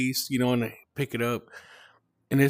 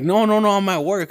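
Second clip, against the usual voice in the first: high, louder baffled protest; puzzled pushback, pitched up and emphatic; retold confused denial; 8 semitones higher, 5 dB louder than usual. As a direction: imitate a dad insisting in confusion. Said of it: "like, confused".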